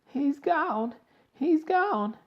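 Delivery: very high horrified cry; scream. A narrator's reading. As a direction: wail it in shock.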